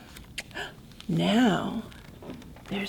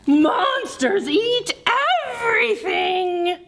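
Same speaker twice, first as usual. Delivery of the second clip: frightened cry; 8 semitones higher than usual. Acted. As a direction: wail it out. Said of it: "said Duck with a cry".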